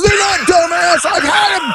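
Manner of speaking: very high, indignant mock outburst; defensive protest. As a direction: imitate a wounded collector protesting. Retold man protesting the insult.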